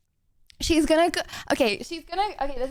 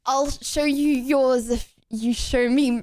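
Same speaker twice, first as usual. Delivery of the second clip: usual speaking voice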